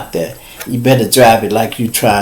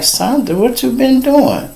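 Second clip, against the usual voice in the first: higher, lightly voiced officer; mock cop voice; 12 semitones higher than usual. Officers questioning his wife, lightly voiced mock cop.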